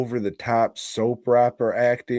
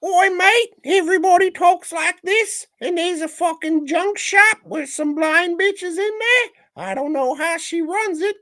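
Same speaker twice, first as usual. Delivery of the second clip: very high, squeaky Cockney caricature; Cockney accent. Acted. Co-host recaps the film to Joe, squeaky mock Cockney. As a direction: put on a shrill, hammed-up Cockney voice. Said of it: "your little Cockney voice or whatever it is".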